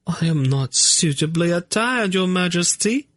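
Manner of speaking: high lady voice protesting; fussing noblewoman objecting; high, put-upon protest. Acted. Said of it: "Narina protested".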